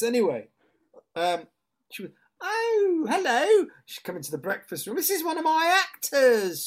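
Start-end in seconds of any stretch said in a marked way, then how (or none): 2.4-3.7 much higher, louder shrill-toned greeting; high-pitched landlady voice. Retold or imitated. Seaside landlady greeting her guest in a high-pitched voice. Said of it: "She had this high-pitched voice". 5.0-6.7 much higher, louder introducing voice; high-pitched landlady voice. Retold or imitated. Landlady presenting her actor to two old ladies, high-pitched. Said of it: "She had this high-pitched voice".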